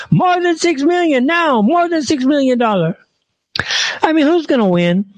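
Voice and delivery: same high put-on voice, now hammed-up outrage; mock indignation. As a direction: play the caricature as shocked and demanding. Mock character keeps the caricature.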